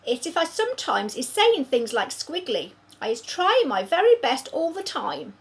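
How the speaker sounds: lightly voiced friendly giant, pleading; a hint of a big friendly giant apologising; giant's voice. BFG explaining to Sophie, lightly voiced giant, earnest.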